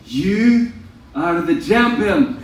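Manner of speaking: a hammed-up Shrek-like Scottish brogue; a mock Scottish champion's boast, slowed down; Scottish accent. Teasing a friend for his pep talk, in a mock Scottish accent. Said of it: "That was very Shrekish".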